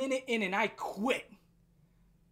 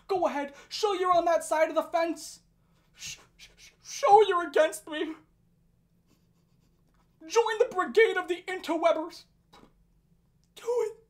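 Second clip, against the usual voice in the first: mock dare; 5 semitones higher, 4 dB louder than usual. Mocking his dare.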